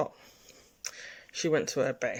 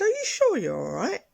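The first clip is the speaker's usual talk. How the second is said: high imitation of an insistent nurse; high-pitched solicitous questioning; nurse's voice; 8 semitones higher, 4 dB louder than usual. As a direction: check on them in a put-on high voice. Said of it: "three nurses came and spoke to me".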